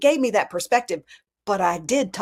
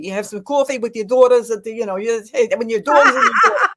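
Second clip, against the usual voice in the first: lightly voiced Bronx Italian accent, louder; a hint of Bronx Italian talk, quick and louder; 4 dB louder than usual. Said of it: "Italian Bronx people who talk like this".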